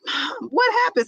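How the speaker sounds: put-on high, dismayed child's cry; a little girl upset at the number; lightly voiced upset daughter. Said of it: "so upset".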